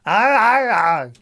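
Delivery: loud, high aye aye chant; silly cheer. Acted. Noise for Martin.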